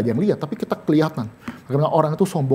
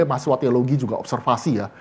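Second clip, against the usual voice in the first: ordinary talk, nothing marked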